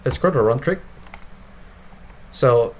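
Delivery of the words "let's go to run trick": ordinary delivery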